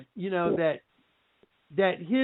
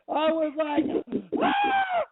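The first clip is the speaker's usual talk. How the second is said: high shrieking yell of shock; freaked-out scream; 8 semitones higher, slower than usual. Retold or imitated. Retelling his reaction on the phone: high freaked-out shriek. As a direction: let out a sudden high shriek. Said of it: "I literally freaked out".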